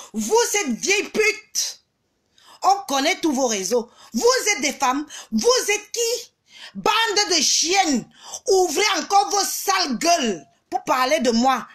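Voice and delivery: high-pitched, indignant tirade; angry insults. Real, not acted. Streamer cursing women who talk about her, angry. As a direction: hurl the insults, stretching key words out.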